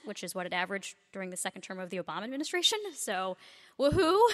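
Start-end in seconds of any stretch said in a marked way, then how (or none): 3.8-4.2 loud, high sarcastic woohoo; mock cheer. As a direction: fake celebration breaking into a laugh. Mock cheer at weak growth.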